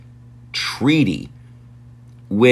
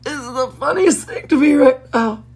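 much higher voice, breaking with laughter; words squeezed out through helpless giggles; laughing fit. Real, not acted. Host cracking up at Bruce Lee writing about the WHO, laughing through it. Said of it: "I'm choking on my own laughter".

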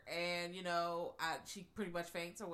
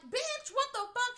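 high shocked shriek, louder; 7 dB louder than usual. Retold or imitated. Anne-Marie finding the stranger in her house, a high shocked shriek. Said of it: "She's like".

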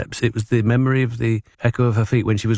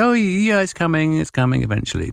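breezy evasion; 9 semitones higher than usual. Retold or imitated. Evasive promise to agent.

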